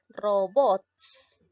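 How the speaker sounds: "Robots" with the final syllable hit, ro-BOTS; stress on BOTS. Shown as a demonstration. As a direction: put the weight on the BOTS of "Robots". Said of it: "la tiene la sílaba bots". ("Robots" con fuerza de voz en BOTS)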